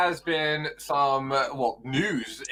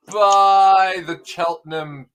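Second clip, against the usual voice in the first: grand announcer intro; 6 dB louder, much slower than usual. Acted.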